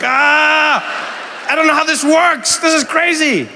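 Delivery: high, loud panicked outburst; comic panic. Retold or imitated. Suddenly managing the Oompa Loompas, in comic panic. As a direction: wail it as if completely overwhelmed. Overwhelmed newcomer in retold panic.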